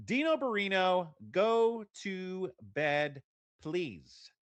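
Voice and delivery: slow, drawn-out bedtime plea; high-pitched call to a child, slowing right down; parent at bedtime. Pretend. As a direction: stretch out each word for a small child. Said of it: "repeat this for my four-year-old son".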